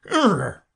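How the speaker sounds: loud creature grunt, pitched high; Gryphon exclamation. A narrator's reading. Gryphon breaks the long silence with a sudden grunt. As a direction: throw out a sudden loud "urgh". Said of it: "an occasional exclamation of".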